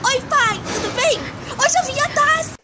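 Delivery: high, bubbly rush of news; excited phone call. Retold or imitated. Daughter calling her mom, bursting with excitement. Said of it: "gritei para o mundo inteiro".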